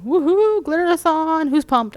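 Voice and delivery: high, loud hype cheer; excited cheer. Real, not acted. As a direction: cheer to pump up viewers. Hyping the next stream.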